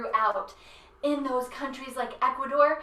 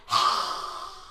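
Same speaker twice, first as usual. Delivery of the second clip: scary lizard hiss. Shown as a demonstration. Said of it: "let's be a scary lizard".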